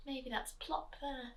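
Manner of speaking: ordinary delivery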